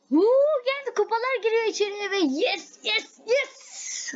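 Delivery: high whoop, then breathless yeses; real joy. Real, not acted. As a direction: streamer finally gets the trophies, real excitement. Trophies finally come in.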